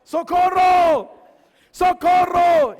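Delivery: long drawn-out, very high shout; screaming for help. Retold or imitated. Boy being beaten calls the neighbour, full-voiced scream. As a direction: yell for rescue at the top of your voice. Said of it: "adivinen qué empecé a gritar".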